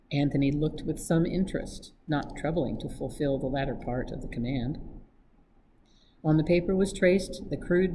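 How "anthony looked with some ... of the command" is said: usual speaking voice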